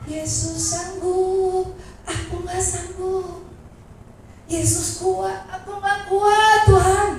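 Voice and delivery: high, slow, half-sung lament; tearful plea. Pretend. A believer crying to the Lord, high and slow. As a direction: plead to Jesus as if weeping. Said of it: "kita bilang".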